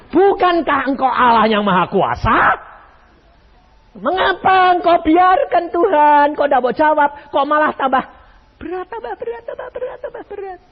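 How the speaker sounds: complaining believer, high whine; whining prayer. Retold or imitated. Congregant whining prayer voiced.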